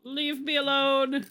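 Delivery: high, slow, pleading yell; high panicked shout. Real, not acted. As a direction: shout it at the monster. Skeleton attacking in game.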